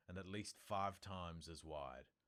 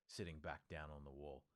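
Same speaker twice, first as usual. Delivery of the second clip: plain reading voice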